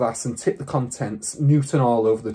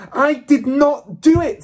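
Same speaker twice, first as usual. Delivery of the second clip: high, loud, slow scream of protest; child screaming her innocence; 14 semitones higher, 8 dB louder, slower than usual. A narrator's reading. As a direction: scream it in outrage. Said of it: "she screamed".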